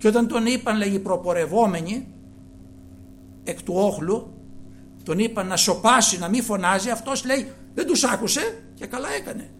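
loud, high, forceful preaching; fiery preaching. Real, not acted. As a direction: build it up, thundering to the crowd. (φλογερό κήρυγμα με δυνατή φωνή)

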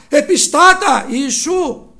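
high, loud plea; crying out loud. Retold or imitated. Preacher voicing lepers crying out, loud. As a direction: shout for mercy from far off. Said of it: "φώναξαν δυνατά".

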